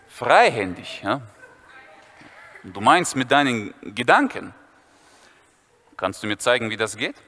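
put-on low, curious boy; low intrigued schoolboy; [name]'s voice.

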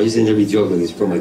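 ordinary talk

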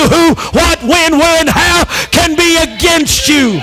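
rising, shouted preaching; preaching at full force. Acted. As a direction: drive it home. Preaching build.

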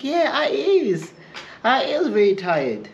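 high, slow imitation of an older lady. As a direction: drag it out slowly, high, as the tired lady.

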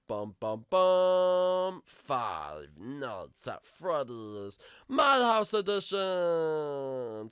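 loud, very drawn-out title announcement; hammed-up slow big reveal; game show announcer. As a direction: announce it hugely, stretching each word.

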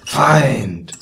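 drawn-out mock guard cry; towers' call. A narrator's reading. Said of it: "riefen auch nicht mehr ständig".

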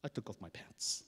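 usual speaking voice

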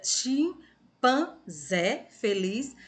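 "chimpanzé" very slowly broken into chim, pan, zé; syllable by syllable. Shown as a demonstration. Teacher rereads for pupils after a slip, "chimpanzé" syllable by syllable. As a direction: draw out "chimpanzé feliz" with a gap after each syllable.

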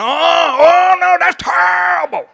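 high, loud, hammed-up horror; mock shocked gasp. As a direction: cry out in fake dismay. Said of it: "See, you're going".

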